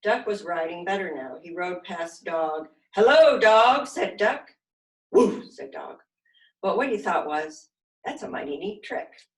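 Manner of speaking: put-on lively, expressive read-aloud for little kids; animated children's story narration; storytime reading. A narrator's reading. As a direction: read it like a silly picture book to a class. Reading picture book to children.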